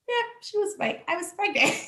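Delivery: laughing at herself; rueful. Real, not acted. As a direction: admit it with a giggle. Admitting she was wrong.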